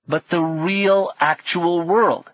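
real, actual world said slowly and high; slow emphatic stress. Acted. Emphasizing awe.

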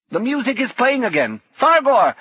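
urgent shout, pitched high; high, loud call with rising urgency. A narrator's reading. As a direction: shout a warning to an unresponsive companion. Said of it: "Pithlet was shouting now".

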